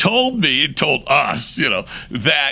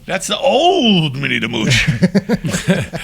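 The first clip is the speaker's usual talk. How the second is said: put-on louder, higher, with a long drawn-out OLD; scornful complaint; 6 semitones higher, 5 dB louder, slower than usual. Singer objects to the director's old-style track, scornful. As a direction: stretch it out in hammed-up scorn.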